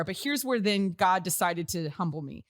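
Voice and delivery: ordinary talk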